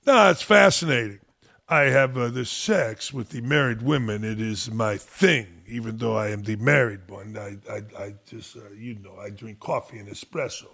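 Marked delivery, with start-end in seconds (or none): 1.7-10.7 put-on Tony Parker French accent. Host ending the Parker bit in a French accent. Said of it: "I kinda like the accent".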